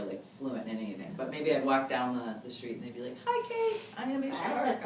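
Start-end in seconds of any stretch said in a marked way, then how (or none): 3.2-3.8 put-on very high, bright child's call; a high child's voice calling out a greeting; kid greeting. Kids greeting her in street. 4.0-4.8 put-on high child voice on "I am a shark"; a lightly high kid voice. Kids repeating English phrase.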